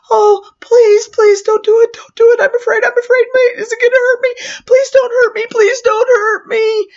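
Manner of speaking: put-on high, loud, panicked begging of a scared boy; the frightened boy begging the clown not to hurt him, panicked and near tears; terrified child. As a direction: plead up high and loud, on the edge of crying. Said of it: "He's hyperventilating".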